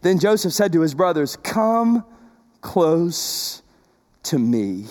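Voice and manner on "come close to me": tender. A narrator's reading. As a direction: gently draw the brothers in. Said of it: "tenderly Joseph going".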